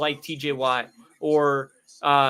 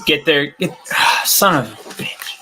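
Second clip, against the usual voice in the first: yelling at the TV; 8 dB louder than usual. Real, not acted. Yelling at the TV play.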